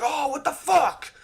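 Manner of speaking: very high, loud mock shock; drawn-out high squawk of alarm; startled awake. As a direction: imitate someone jolted out of sleep. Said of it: "she wakes up suddenly".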